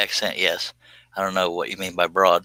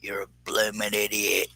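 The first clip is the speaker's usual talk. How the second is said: softer mock-Aussie insult; Australian talking crap; 6 dB quieter than usual. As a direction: put on an Australian accent to trash-talk. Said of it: "trying to be an Australian talking crap without cussing".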